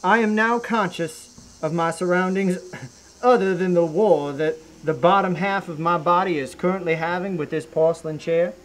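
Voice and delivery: normal talking voice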